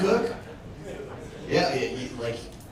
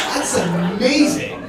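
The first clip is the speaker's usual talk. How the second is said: usual speaking voice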